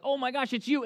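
high-pitched delighted recognition; happy amazement. Retold or imitated. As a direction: react with happy disbelief, imitating them. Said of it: "they're so happy".

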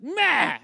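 high, loud dismissive Meh; sulky son shrugging off dad, mock grunt; mock kid grunt. Kid's dismissive noise.

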